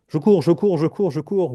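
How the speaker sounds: urgent mock racing patter; frantic running. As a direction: chant it like a hamster on its wheel. Acting racehorse or hamster running.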